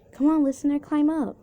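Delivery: high, loud call from up a tree. Acted. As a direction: call a friend to join you. Calling down from the tree.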